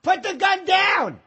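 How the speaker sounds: hammed-up Pacino yelling at the cops; Pacino shout. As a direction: bellow it like a hostage standoff. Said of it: "Dog Day Afternoon".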